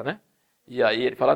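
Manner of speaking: ordinary delivery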